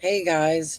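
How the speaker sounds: ordinary talk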